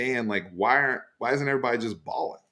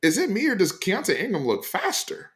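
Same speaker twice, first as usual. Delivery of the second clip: hyped fan voice; 8 semitones higher than usual. Retold or imitated. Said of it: "people in the comments on my tweet were like".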